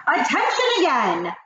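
imperious witch shout; shouted command. A narrator's reading. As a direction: call the room sharply to order. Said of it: "the Grand High Witch was shouting".

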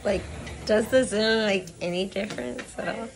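lips stretched back by retractor; talking through cheek retractor; mouth held open. Real, not acted. Seen 1: lip retractor holds mouth open, lips pulled back while talking.

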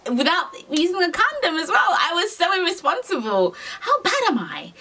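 high-pitched, half-laughing self-reproach; appalled at herself. Real, not acted. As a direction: confess it in a high, laughing squeal. Mock horror at her own recklessness.

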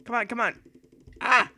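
ordinary delivery

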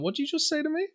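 high, soft puzzled Cali bloke; confused Californian. Retold or imitated. Bloke from California, baffled, asking in a high puzzled voice. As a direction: squeak it out, baffled by the accent. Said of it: "he's like".

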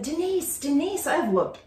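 put-on a little girl calling her friend in distress; girl phoning her friend about missing presents; Julie on the phone. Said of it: "She called her friend ... and said".

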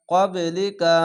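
কবলিকা with the ব bounced in a short echo; qalqala bounce. Shown as a demonstration. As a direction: give the ব of কবলিকা a quick bounce. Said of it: "কলকলা ধাক্কা মেরে নিচে sound আসবে". (কবলিকা কলকলা ধাক্কা দিয়ে)

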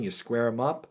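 usual speaking voice

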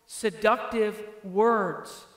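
"seductive words" drawn out slowly, each word weighted; slow stressed reading. Shown as a demonstration. Pastor stressing the scripture's warning to the congregation, slow and weighted. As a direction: lean hard on "seductive words" and let them hang. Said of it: "and, and notice this, it's important".

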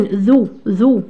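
drawn-out ذو, repeated; careful pronunciation. Shown as a demonstration. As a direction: say ذو very slowly, twice. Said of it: "شر الناس ذو الوجهين".

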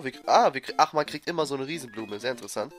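usual speaking voice

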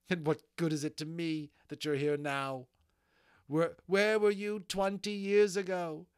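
high, loud, anguished accusation; bitter outrage. Acted. Molly berates the unicorn for coming too late, outraged.

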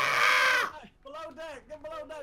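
high, loud alarmed yell. Real, not acted. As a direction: shout the warning urgently. Ship under fire, yelling.

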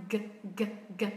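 G released sharply from the back of the tongue; voiced g burst. Shown as a demonstration. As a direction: build air then pop the G. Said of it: "âm g".

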